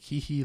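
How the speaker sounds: soft, high "hee hee" titter; mock an anime character's giggle; imitated cutesy giggle behind a hand. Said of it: "I'm actually an anime character".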